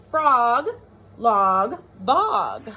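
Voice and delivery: each word lifted and set apart; teacher pointing out the rhymes; rhyming words called out. Shown as a demonstration. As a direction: count out the rhymes one by one. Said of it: "three rhyming words".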